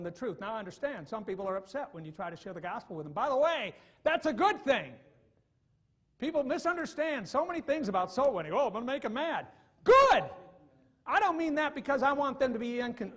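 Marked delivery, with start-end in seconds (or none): 3.1-9.5 loud, raised preaching voice; fiery preaching. Acted. Preaching at full force. 9.8-10.2 shouted high and loud; emphatic shout. Acted. Preacher's emphatic exclamation.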